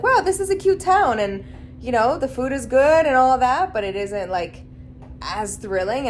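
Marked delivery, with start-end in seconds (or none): 0.0-1.2 surprised. Retold or imitated. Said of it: "now it's like".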